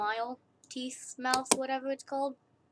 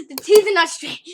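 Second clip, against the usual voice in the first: mock complaint shouted, higher and louder; loud mock complainer; 7 semitones higher, 16 dB louder than usual. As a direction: yell it like an annoyed critic.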